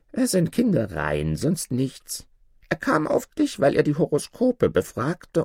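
high-pitched, animated dwarf voice; dwarf storyteller. Acted. Dwarf explains to Aladdin. (hohe, lebhafte Zwergenstimme)

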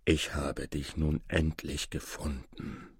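slow, hushed voice of a moved stranger; softly, slowly, holding back emotion; tearfully moved. A narrator's reading. Stranger finding his nephew at last, tearfully moved. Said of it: "begann dann zu weinen".

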